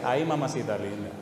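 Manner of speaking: ordinary talk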